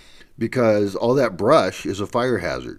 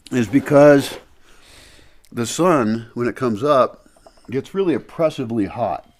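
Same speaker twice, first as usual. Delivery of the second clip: talking around a candy; candy in the mouth; mouth full; 4 dB louder than usual. Real, not acted. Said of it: "I've got a candy in my mouth".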